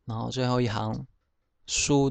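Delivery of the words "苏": last 苏 as "soo", open o; Hokkien reading. Shown as a demonstration. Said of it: "苏念成苏".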